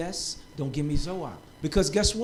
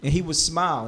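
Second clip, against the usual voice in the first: usual speaking voice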